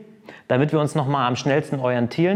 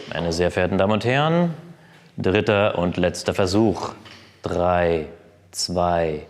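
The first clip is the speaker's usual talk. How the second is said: low, slow announcer voice; 9 semitones lower, slower than usual. Pretend. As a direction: announce it like a stadium host. Mock stadium announcement before lift.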